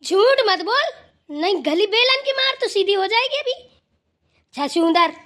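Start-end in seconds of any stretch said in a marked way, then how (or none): 0.0-3.5 higher-pitched scolding threat; angry scolding. Acted. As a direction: snap at the liar and threaten her. Grandma threatens lying neighbour.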